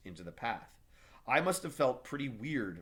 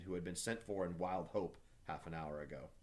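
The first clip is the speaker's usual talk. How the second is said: usual speaking voice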